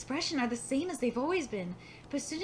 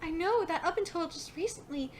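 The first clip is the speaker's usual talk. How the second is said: ordinary delivery, nothing marked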